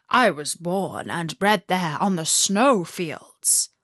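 a beast proud of its home; proud reindeer. A narrator's reading. Reindeer eyes sparkled.

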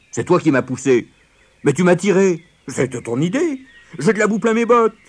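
high, loud squabbling; arguing. A narrator's reading. Soaked companions blaming each other on the bank, arguing loudly. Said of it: "se mirent à se disputer".

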